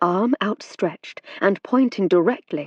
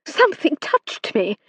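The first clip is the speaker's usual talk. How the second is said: high frightened cry; terrified man at seance; 9 semitones higher than usual. A narrator's reading. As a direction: shaken by a ghostly touch. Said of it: "in a terrified voice".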